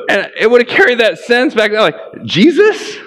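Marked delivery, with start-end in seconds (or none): 2.2-3.0 much higher, rising "Jesus?" of disbelief; as if the idea is absurd; incredulous. Retold or imitated. First-century listener hearing Jesus is Lord, shocked and rising high.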